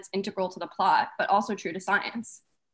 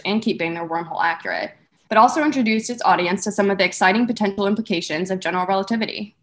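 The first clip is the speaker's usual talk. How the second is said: louder, eager awards show host; red carpet host; 7 dB louder than usual. Acted. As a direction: hype it up like a live host.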